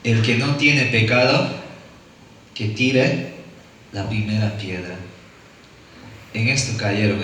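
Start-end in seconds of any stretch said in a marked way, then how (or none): 0.0-5.0 slow, measured pronouncement, softer at the end; a hint of Jesus speaking with quiet authority; Jesus addressing the accusers, quietly solemn; solemn Jesus quote. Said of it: "Y dice así a las personas".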